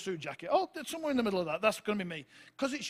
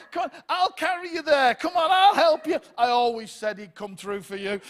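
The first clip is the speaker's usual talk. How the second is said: eager crowd; 6 semitones higher, 9 dB louder than usual. Retold or imitated. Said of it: "they're all like".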